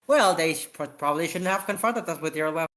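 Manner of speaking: plain reading voice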